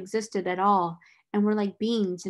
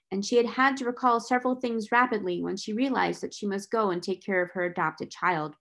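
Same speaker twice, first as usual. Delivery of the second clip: ordinary delivery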